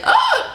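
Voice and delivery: very high short shriek of confusion; exasperated shriek. Retold or imitated. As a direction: squeal out the overwhelm.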